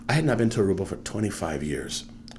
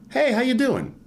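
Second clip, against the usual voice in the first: bright, much higher hello as if passing someone; cheery greeting; 3 dB louder than usual. Retold or imitated. Greeting a stranger on the street, bright and cheery. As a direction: toss out a breezy hey in a lifted voice.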